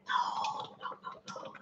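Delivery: breathy, hushed denial; whispered no. Real, not acted. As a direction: whisper it in disbelief. Whispered denial after the news.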